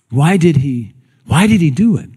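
ordinary delivery

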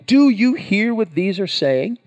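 scandalized priests challenging Jesus; indignant question. Retold or imitated. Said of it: "They were indignant".

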